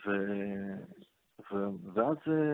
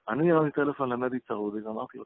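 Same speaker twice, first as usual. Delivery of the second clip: normal talking voice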